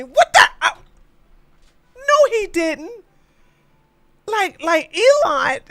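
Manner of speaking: real incredulous yelp, voice flying up; incredulous high outburst. Real, not acted. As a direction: squeal in outraged surprise. Outraged disbelief at headline.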